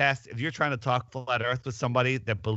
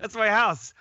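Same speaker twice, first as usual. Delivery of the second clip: more excited; 10 semitones higher than usual. Pretend. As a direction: shout it with growing delight. Repeated louder, higher.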